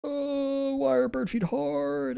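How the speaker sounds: very high, soft, drawn-out whine; mock wail. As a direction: whine it slowly, high and thin, in mock despair. Mock lament over drawing difficulty.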